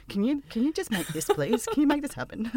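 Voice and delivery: high, laughing plea; pleading. Retold or imitated. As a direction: beg sweetly and eagerly. Begging writer contacts for scripts.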